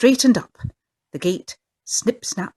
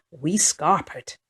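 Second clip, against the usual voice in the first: ordinary delivery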